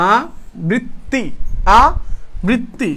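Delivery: "আবৃত্তি" very slowly, in separate syllables aa, brit, ti; syllable-by-syllable demo. Shown as a demonstration. Teacher confirming the answer for students, slowly sounding out "আবৃত্তি". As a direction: pull "আবৃত্তি" apart with pauses between the syllables. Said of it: "আবৃত্তি, এভাবে হবে".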